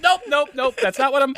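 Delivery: a hint of a father calming things down; imitating dad backpedaling. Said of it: "he's like".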